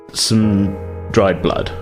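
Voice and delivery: normal talking voice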